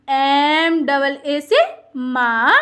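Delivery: slow, deliberate letter-by-letter spelling. Shown as a demonstration. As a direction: spell it out slowly for beginners. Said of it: "spelling out for pupils". (धीरे-धीरे अक्षर बोलकर)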